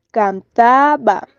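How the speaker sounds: "cantaba" slowly, TA stretched and stressed; "cantaba" as a slow stress demo, weight on TA; stress on penultimate syllable. Shown as a demonstration. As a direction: draw out TA in "cantaba". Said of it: "el acento está en la penúltima sílaba".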